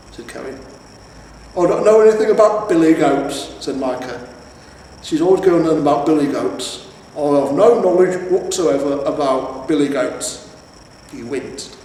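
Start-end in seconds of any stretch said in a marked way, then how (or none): 1.6-10.5 loud, flustered denial; defensive troll. A narrator's reading. Troll husband denying billy goats to the hero, flustered. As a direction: protest too much.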